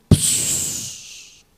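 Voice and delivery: long hissed puff of breath; imitated blowing. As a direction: blow out a sharp "pss" of air. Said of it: "Nembus tuh ke". (tiruan suara embusan napas)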